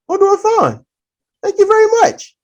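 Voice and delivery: high-pitched, prim little reply; mimicked polite man. Speed-walker answering the dock attendant's greeting, mimicked high and polite.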